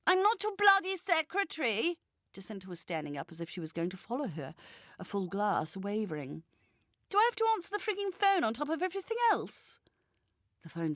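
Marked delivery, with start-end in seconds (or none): 0.1-2.0 much higher, louder indignant retort; Jacinta snapping. Acted. 7.1-9.8 much higher, fast indignant rant; Jacinta protesting. Acted. Jacinta protesting, drunk and cross.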